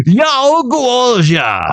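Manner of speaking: high, drawn-out angry mock-Chinese cry. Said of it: "in an angry Chinese voice".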